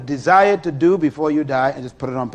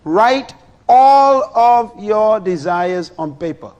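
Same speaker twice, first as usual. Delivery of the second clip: high, loud and slow, each word weighed; slow emphatic instruction; 6 semitones higher, 7 dB louder, slower than usual. Real, not acted. Preacher telling the congregation to write desires, slow and loud. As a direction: dictate it word by word. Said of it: "preacher dictates step two slowly".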